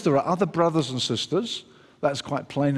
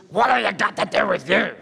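raspy, higher-pitched demon cry; screaming demoniac; 5 semitones higher, 3 dB louder than usual. Acted. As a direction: scream it like a man possessed. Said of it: "he starts screaming".

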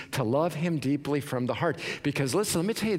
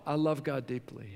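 quiet reply; 5 dB quieter than usual. Acted. Quiet answer after mock question.

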